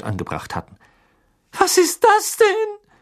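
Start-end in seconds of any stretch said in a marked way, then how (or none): 1.5-2.9 very high, slow, loud gasping voice; horrified gasp. A narrator's reading. Aunt staring at the fireplace, horrified gasp. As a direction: gasp it out in shock. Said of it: "keuchte Tante Petunia".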